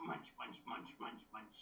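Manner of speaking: whispered chomping munch sounds; Munchlax munching. Pretend. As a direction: whisper it like a tiny creature eating. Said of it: "That's what Munchlax sounds like".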